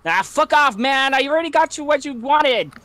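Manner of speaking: put-on a young guy shouting back in defiance; younger voice yelling. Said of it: "you hear the younger voice".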